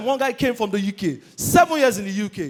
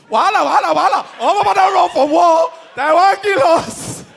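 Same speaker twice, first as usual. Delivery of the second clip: high, loud hollered warning; alarmist snitch; 8 semitones higher, 8 dB louder than usual. Acted. Snitch yelling that people ran from war, high holler. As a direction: holler it out in mock panic, dragging the last words. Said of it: "people like [name] that will snitch".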